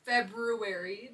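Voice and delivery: "February" drawn out slowly, syllable by syllable; careful pronunciation. Shown as a demonstration. Showing a friend the proper word, "February" slow and careful. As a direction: stretch "February" out with the R after the B. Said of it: "That's how you pronounce it".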